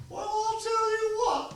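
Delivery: put-on high, slow, drawn-out old-timer voice; old man Callahan voice. Telling a local crowd how the old man talked, high old-timer voice. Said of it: "he kinda talked like this".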